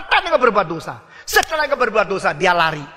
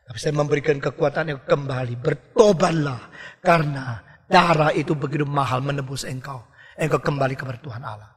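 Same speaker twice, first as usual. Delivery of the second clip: softer, lower sorrowful plea; grieving Holy Spirit; 7 semitones lower, 4 dB quieter than usual. Retold or imitated. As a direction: speak as a grieved Spirit calling back. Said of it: "Roh Kudus berkata".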